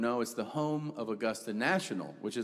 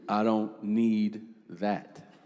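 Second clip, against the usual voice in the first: low, slow sulky refusal; sulky kid; 4 semitones lower, much slower than usual. Retold or imitated. Brother refuses bread again, low, slow and sulky. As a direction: drag it out like a sullen kid. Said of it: "He goes".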